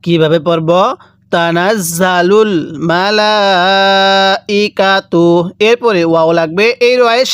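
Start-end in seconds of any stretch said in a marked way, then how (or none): 1.3-5.3 slow recitation holding the লা of মালাইকাতু for four alifs; four alif stretch. Shown as a demonstration. Teacher recites the verse slowly with the long four-alif madd. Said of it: "এজন্য এখানে চার আলিফ টান দিব".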